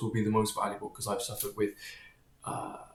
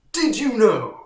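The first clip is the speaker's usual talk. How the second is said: put-on much higher and louder, an eager know-it-all kid; annoying kid voice; 10 semitones higher, 10 dB louder, much slower than usual. Boy telling his friends a science fact, high and eager. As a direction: pipe up like a kid bursting with a fact. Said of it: "I was so annoying as a kid".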